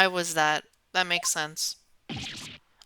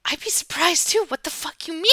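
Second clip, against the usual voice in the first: incredulous retort; 7 semitones higher, 4 dB quieter than usual. Retold or imitated. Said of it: "I was like".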